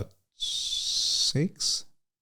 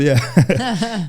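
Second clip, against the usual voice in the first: a real yes that breaks into a laugh, pitched much higher; 9 semitones higher, 5 dB louder than usual. Real, not acted. Laughing reply to Oh really.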